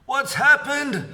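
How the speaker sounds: high, loud, slow panicked scream. A narrator's reading. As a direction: cry out in alarm. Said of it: "screamed Missus Twit".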